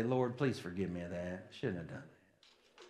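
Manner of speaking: very soft, low, private confession; quiet contrite prayer. Retold or imitated. Believer asking the Lord's forgiveness, quietly contrite. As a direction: drop right down into a hushed, penitent aside.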